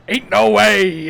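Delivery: loud, high pirate growl. Retold or imitated. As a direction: say it like a swaggering pirate. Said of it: "in a pirate voice".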